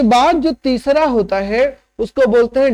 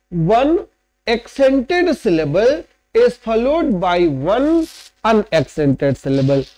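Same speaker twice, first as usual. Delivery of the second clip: very slow, deliberate dictation; slow dictation pace for students; slow teaching drill; much slower than usual. Shown as a demonstration. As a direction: spell out the rule word by word.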